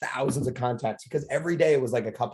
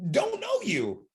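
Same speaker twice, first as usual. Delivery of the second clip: emphatic; much slower than usual. Real, not acted. Emphatic point to audience.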